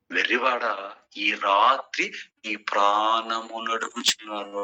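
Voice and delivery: slow, drawn-out divine pronouncement; God pronouncing judgement. Acted. God sentencing the fool this very night, solemn and drawn-out. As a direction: draw out each word as a solemn sentence.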